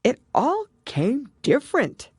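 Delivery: a hint of a pondering creature; thoughtful Mock Turtle. A narrator's reading. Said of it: "repeated thoughtfully".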